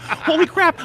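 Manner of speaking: high, excited exclamation; surprised. Real, not acted. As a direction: blurt it out in disbelief. Reacting to celebrity message.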